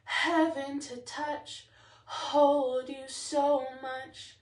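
HEAVEN and HOLD opened with a big H, drawn out; big initial H. Shown as a demonstration. Choir teacher showing singers the H, big and drawn-out H on Heaven and hold. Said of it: "make that H really big".

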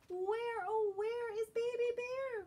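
high, carrying call for a lost cub; Mama Bear calling. Acted. Mama Bear searching for her missing cub, calling high. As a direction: call out high across the woods. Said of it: "calls Mama".

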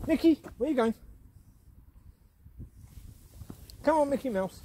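calling the dog. Real, not acted. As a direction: call him back.

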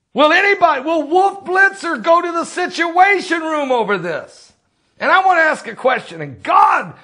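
fired-up preacher calling people out; impassioned shout. Real, not acted. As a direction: cry it out from the pulpit. Preacher ranting against public figures.

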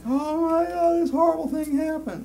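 very high, loud mock wail; hammed-up hysterical grief; overwrought mourner. Mocking someone upset over celebrity news, overwrought wail. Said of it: "way over".

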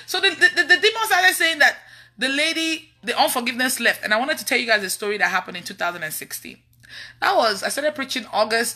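usual speaking voice

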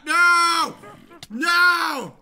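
loud, high drawn-out "No!"; alarmed shout. Real, not acted. Player to the game as things go wrong, alarmed shout. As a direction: yell it in sudden dismay.

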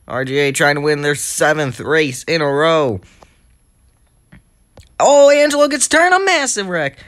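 excited play-by-play. Real, not acted. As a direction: race commentary rising into a high, loud crash call. Live race commentary, action heats up.

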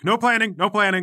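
high, loud, repeated command; shouted order. Acted. Kid with a spear forbidding planning, shouted order.

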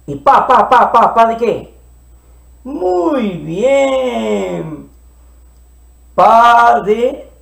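normal talking voice

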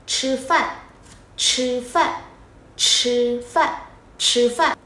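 "Chứ phạn" với ch bật hơi kiểu Trung; "chứ phạn" đọc như chī fàn; tiếng Trung chī fàn. Shown as a demonstration. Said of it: "Trong tiếng Trung có một từ đó là ăn cơm". (phát âm "chứ phạn" tiếng Trung)

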